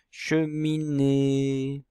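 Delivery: Cheminée drawn out, che-mi-née, with the final é held; very slow demo word. Shown as a demonstration. Teacher to pupils, sounding out the word slowly. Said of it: "Dans quelle syllabe?".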